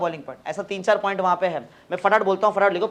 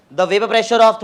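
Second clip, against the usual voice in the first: slow dictation; 2 semitones higher, 6 dB louder, much slower than usual. Shown as a demonstration. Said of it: "ऐसा लिखो first point".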